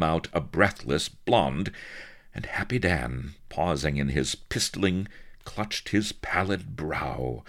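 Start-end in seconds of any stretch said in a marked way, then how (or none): none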